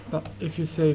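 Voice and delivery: ordinary talk, nothing marked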